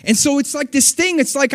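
impassioned sermon delivery; full-force preaching. Acted. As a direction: preach it out, lifting the room.

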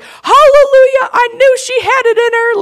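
very high, loud imitated rejoicing; jubilant dad. Dad hearing of his daughter's healed knee, high jubilant imitation. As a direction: imitate an overjoyed old dad crying out.

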